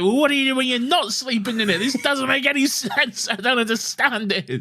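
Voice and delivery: very high-pitched imitation of total bafflement; hammed-up, wailing incomprehension; freaking-out Doctor. Fan acts out the Doctor failing to grasp bedrooms, comic freak-out. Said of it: "the Doctor is freaking out".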